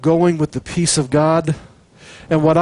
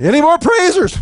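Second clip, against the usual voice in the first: much higher, mock-incredulous call; Joshua calling out; 12 semitones higher than usual. Joshua asking Joseph for more praisers, high mock call. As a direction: squeak it out like a doubtful general. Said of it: "Joshua's gonna say".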